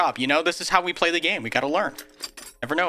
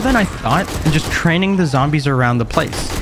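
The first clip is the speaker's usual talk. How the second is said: louder, steady recap narration; voiceover narration; 6 dB louder than usual. A narrator's reading. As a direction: narrate the day like a video recap.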